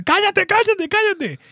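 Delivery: very high shrieking shut up; shouting. Retold or imitated. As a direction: screech it in exasperation. Acting Chandler yelling shut up.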